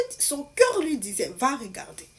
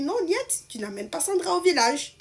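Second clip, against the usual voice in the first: firm refusal; 7 semitones higher, 4 dB louder than usual. Retold or imitated. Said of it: "son mari dit".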